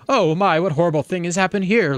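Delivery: horrified gasp. Acted. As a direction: recoil in horror at the sight. Barry sees dead bugs in horror.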